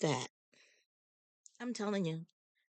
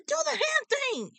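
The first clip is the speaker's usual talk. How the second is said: excited request. Retold or imitated. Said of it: "She said".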